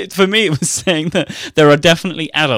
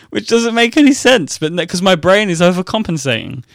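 usual speaking voice

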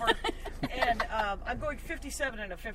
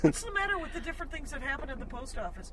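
light Seinfeld-style rising bit; lightly voiced Seinfeld observational lilt; comic hamming up a Seinfeld bit for friends, lightly voiced; Jerry Seinfeld impression; 5 semitones higher than usual. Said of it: "that Jerry Seinfeld bit".

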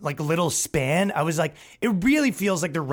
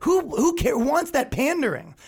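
much higher, slowed scoff; incredulous; 12 semitones higher, slower than usual. Real, not acted. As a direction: sneer at the pandering. Incredulous outburst, high.

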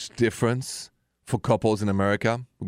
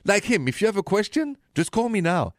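ordinary talk, nothing marked

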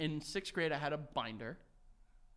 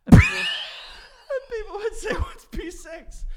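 high, slow words squeezed out through loud laughter; can barely get it out for laughing; laughing hard; 15 dB louder, slower than usual. Real, not acted. Telling his friend the old joke, laughing too hard to speak. Cracking up retelling the tag.

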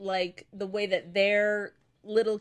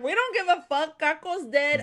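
higher-pitched retold defiance; defiant retort; 5 semitones higher than usual. Kids answering the rival gang leader back, defiant retort. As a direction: imitate kids brushing off a threat.

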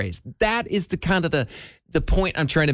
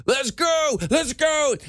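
higher, excited "let's go"; hype cheer; 9 semitones higher, much slower than usual. Retold or imitated. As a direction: cheer like a fan online. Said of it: "every time they make the".

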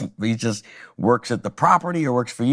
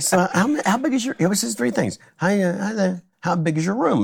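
higher, lightly mocking Jay voice; imitating Jay; 8 semitones higher than usual. Jay greets the comic in a higher mock voice. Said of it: "and he's like".

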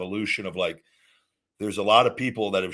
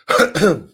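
short real burst of laughter; amused laugh; 7 semitones higher, 10 dB louder than usual. Real, not acted. Laughs at chips excuse.

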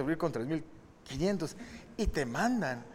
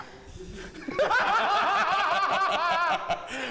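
long, high-pitched belly laugh; laughing hard; 14 semitones higher than usual. Real, not acted. Host cracks up at his own joke about names, high laughter.

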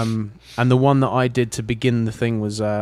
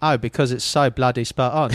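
mock British. Teasing praise of Michael Caine.

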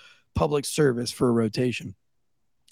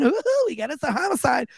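much higher, bubbly mock girl voice; excitable girl dispatcher; 11 semitones higher than usual. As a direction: chirp it out like she loves the drama. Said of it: "that little girl that talks like this".

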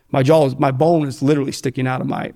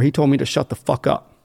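normal talking voice

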